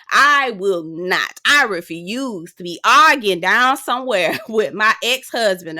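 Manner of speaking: slow, deliberate refusal; slow emphatic refusal. Retold or imitated. Vlogger imagining refusing to argue, slow and emphatic. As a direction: spell out each word of the refusal. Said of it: "And I said".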